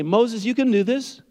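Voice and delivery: high-pitched mock pep talk; pumped-up encouragement. Preacher acting out what God doesn't say to Moses, high-pitched hype. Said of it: "this kind of pump everybody up thing".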